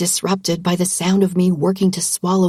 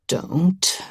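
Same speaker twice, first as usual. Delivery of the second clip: low menacing rasp, softer on move; low threatening rasp; 4 semitones lower, 4 dB quieter than usual. A narrator's reading. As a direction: growl it quietly as a threat. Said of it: "a low, threatening rasp".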